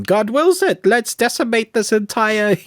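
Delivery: high-pitched mock crusader; mock righteous conqueror.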